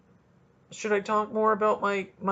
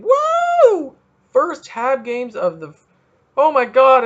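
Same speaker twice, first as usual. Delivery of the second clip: high whooping cheer, louder; excited cheer; 7 semitones higher, 8 dB louder, slower than usual. Real, not acted. Podcaster hyped for the first Habs game, loud whoop. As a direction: cheer for hockey season.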